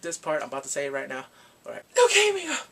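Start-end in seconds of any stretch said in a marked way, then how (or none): 2.0-2.7 high, loud yelp; dramatic reveal. Pretend. Revealing the illness to viewers, high loud outburst. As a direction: blurt the bad news out loudly. Blurting out the spoiler.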